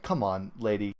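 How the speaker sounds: ordinary talk, nothing marked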